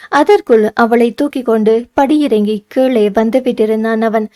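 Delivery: ordinary delivery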